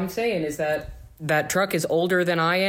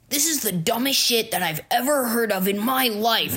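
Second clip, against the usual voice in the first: mock angry customer; 5 semitones higher than usual. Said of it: "they would be like".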